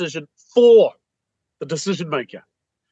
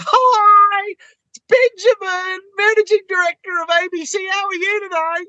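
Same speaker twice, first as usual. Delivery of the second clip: much higher, sing-song fake cheer; happy clappy caller; 14 semitones higher than usual. Salesman chirping at an MD, squeaky high mock cheer. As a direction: Tigger on speed, mocking it. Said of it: "You don't hear M Ds phoning other M Ds going".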